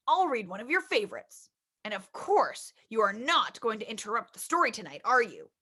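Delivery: low, soft father voice; a gentle low dad tucking in his child; reading the father's lines low and soft; papa voice. Acted.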